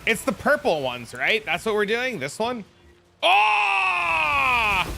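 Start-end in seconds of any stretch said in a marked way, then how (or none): 3.2-4.9 a very high, loud, long shout of Oh; shocked shout. Real, not acted. Streamer seeing a great drop, real shocked shout. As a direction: yell out in sudden shock.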